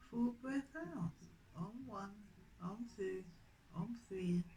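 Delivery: soft, unhurried counting of each breath; guided breathing count. Acted. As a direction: count the breaths in and out gently, like a yoga teacher. Leads the counted breathing practice.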